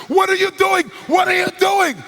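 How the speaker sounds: high, loud shouted outrage, said twice; shocked shout. Retold or imitated. Chairman just punched in the shoulder, shouting in shock. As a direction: yell it like you've just been struck. Hit man reacts, retold.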